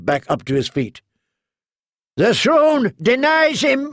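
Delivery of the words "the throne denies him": very high, slow cry; cried out. A narrator's reading. Knight proclaims against the wounded king, high slow cry. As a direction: proclaim it with fervor. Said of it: "he cried".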